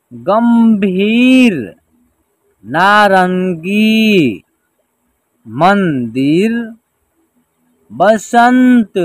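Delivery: measured reading-lesson delivery, long held syllables; word-by-word pronunciation drill. Shown as a demonstration. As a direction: stretch each word for learners to repeat. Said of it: "एक साथ उच्चारण करेंगे".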